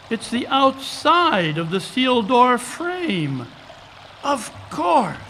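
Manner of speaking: excited. Acted. Holmes to Watson, grasping how Moriarty got in, excited. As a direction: let the realization burst out. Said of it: "what are you so excited about".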